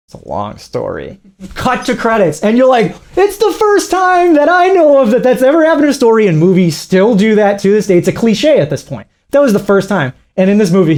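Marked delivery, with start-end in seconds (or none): 0.1-1.2 low, hushed hero's closing line; understated movie line. Retold or imitated. 3.2-6.3 high-pitched mock amazement; excited viewer. Acts viewer's excited reaction to ending.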